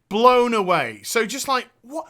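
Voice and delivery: normal talking voice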